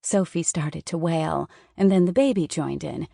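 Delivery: ordinary delivery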